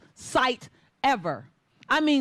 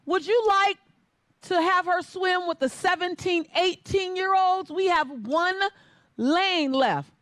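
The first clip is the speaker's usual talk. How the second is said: judge yelling; 8 semitones higher than usual. Retold or imitated. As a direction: call out to the coach. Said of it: "He yells out".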